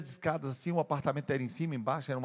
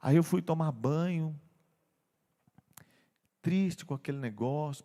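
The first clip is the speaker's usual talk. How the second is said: usual speaking voice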